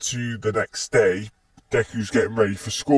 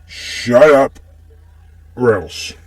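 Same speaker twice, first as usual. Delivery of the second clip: mildly angry, loud at first; firm warning, first words punched louder; a touch of menace, easing off after a loud start; mild threat; 6 dB louder than usual. Acted. Deku threatens the bully after sighing.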